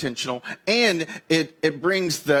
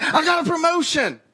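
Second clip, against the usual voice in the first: much higher, louder, thrilled news; excited announcement; 13 semitones higher, 4 dB louder than usual. Acted. Partner comes home thrilled, sharing a promotion. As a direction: blurt it out overjoyed. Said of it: "It's like".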